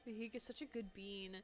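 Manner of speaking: normal talking voice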